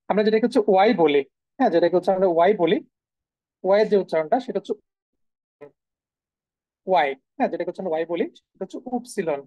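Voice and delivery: ordinary talk, nothing marked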